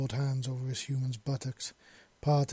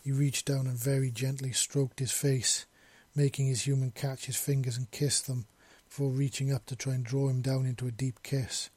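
ordinary delivery, nothing marked

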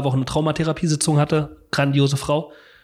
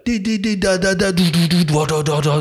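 imitated trip pulse; 3 semitones higher, 3 dB louder than usual. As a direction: mimic a relentless descending machine pulse. Imitates the trip's pulsing sound.

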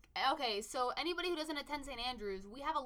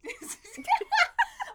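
shrieking burst of laughter; high laugh; 8 dB louder than usual. Real, not acted. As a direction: let out a squealing laugh. Cracks up at the accent.

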